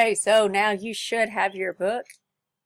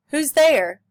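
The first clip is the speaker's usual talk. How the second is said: louder, higher alarmed shout; the man calls toward the trees; startled call; 8 semitones higher, 11 dB louder than usual. Acted. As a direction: call out at the stranger.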